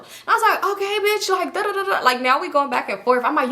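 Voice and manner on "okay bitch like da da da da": high-pitched mock agreement; high, dismissive clapback; retold sassy comeback. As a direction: toss back a sassy retort. Acts own comeback in quarrel.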